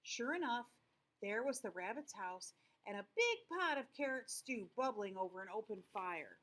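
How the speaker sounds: usual speaking voice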